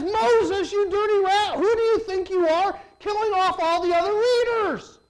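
high, hammed-up indignant yell; mock angry accusation. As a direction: accuse Moses like a cartoon villain. Israelites accuse Moses again.

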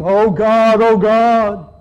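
loud cry at the speaker's own pitch; Spirit's groaning cry. Acted. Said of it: "the Holy Spirit's going".

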